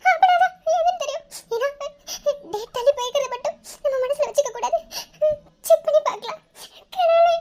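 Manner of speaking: high, sobbing worry to herself; tearful distress. Acted. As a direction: fret through tears with broken pauses. Distressed girl fears pregnancy, alone. (அழுகையுடன் தவிப்பு)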